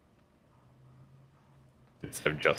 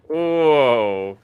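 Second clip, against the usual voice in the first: slow, loud drawn-out whoa; stunned whoa; 8 semitones higher, 10 dB louder than usual. Real, not acted. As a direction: react in shock. Reaction to shocking anime custom.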